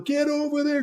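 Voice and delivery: sharp high shout of an order; mock yell. Officer yelling at a lieutenant, high shout. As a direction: bark the order across the street.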